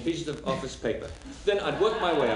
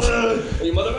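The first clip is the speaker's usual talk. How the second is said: angry; 6 semitones higher, 6 dB louder than usual. Acted. Onscreen fight bit.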